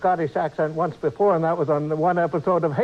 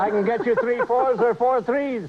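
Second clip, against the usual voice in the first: hammed-up Yiddish-accented haggler; 3 semitones higher than usual. Engineer offers captain a wholesale deal, Yiddish accent. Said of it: "they picked a different accent".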